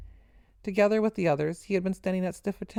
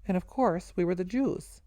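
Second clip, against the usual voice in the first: ordinary delivery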